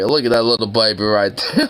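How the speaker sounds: ordinary delivery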